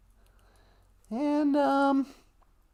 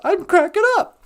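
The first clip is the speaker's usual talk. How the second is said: mock panic; 5 semitones higher, 6 dB louder than usual. Acting Robin doubting his sanity.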